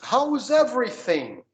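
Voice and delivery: "How's everything?" said slowly, with a clear TH in "everything"; careful TH. Shown as a demonstration. Teacher models the TH in "everything" for the student. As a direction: slowly stress "How's" and bite the TH. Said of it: "ó o T H de novo".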